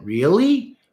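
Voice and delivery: hammed-up false modesty, pitch climbing high; fake-humble, flattered disbelief; mock flattered therapist. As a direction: act astonished at praise, with rising questions. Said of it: "A lot of therapists get into that".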